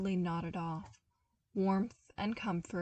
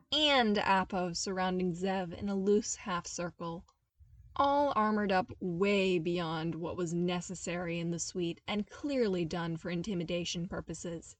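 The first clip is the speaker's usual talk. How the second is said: plain reading voice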